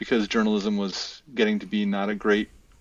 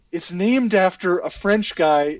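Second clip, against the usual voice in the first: slow, higher, louder insistence; exasperated wife; 7 semitones higher, 5 dB louder, slower than usual. Retold or imitated. Quoting his wife on the name, slow exasperated insistence. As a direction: quote an exasperated wife, stressing each point. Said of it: "that drives my wife crazy".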